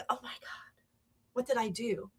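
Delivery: hushed whisper of dismay; whispered realization. Retold or imitated. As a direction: whisper it as the truth sinks in. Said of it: "My mature brain is now going".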